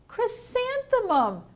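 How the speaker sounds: high, drawn-out mocking name; mocking answer. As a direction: stretch the name out high in mockery. Classmates mockingly give the answer.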